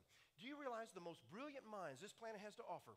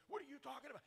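high, incredulous challenge; mock indignant; 8 semitones higher, faster than usual. Imitating the heckler's outraged question, incredulous. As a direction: toss it back as the baffled heckler. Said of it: "He's like, he's like".

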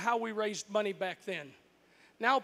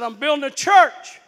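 1 calling back; 3 semitones higher, 14 dB louder than usual. Retold or imitated. Dad answering from the roof.

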